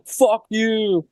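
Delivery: high mock snarl; imitating the woman's parting insult; angry homeless lady. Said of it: "is like".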